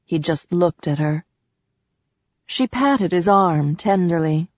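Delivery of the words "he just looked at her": plain reading voice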